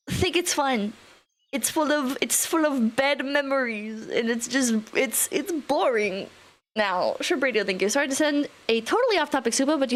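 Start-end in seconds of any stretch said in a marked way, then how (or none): none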